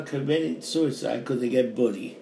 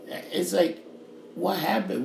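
ordinary delivery

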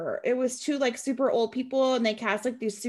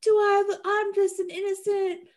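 high-pitched Southern belle, playing innocent; put-on Southern accent; 9 semitones higher than usual. Defendant pleading with the judge she's no flight risk, mock Southern accent. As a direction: plead sweet-little-girl innocence in a hammed-up Southern accent. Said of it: "I suddenly have a Southern accent".

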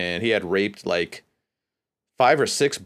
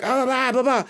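high-pitched mock yell in babble; imitated yelling; 14 semitones higher than usual. Storyteller mimicking the brother yelling at him, high mock yell. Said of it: "started, like, yelling at him".

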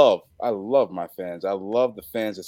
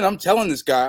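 normal talking voice

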